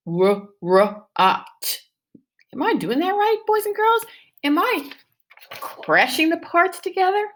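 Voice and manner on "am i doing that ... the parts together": playful high question. Shown as a demonstration. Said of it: "teacher asks class playfully".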